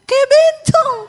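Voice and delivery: very high, loud mock shriek; husband's appalled outburst, hammed up; horrified shout. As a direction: yell it high in comic horror. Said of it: "dia teriak".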